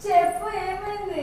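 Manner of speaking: exasperated, falling at the end; insistent demand. Real, not acted. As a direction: raise your voice and demand an answer. Demanding answer from laughing sister.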